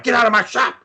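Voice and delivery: mock enraged yell, high pitched; high-pitched retold screaming. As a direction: imitate a furious boss throwing someone out. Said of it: "he just starts screaming at his face".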